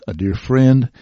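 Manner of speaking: normal talking voice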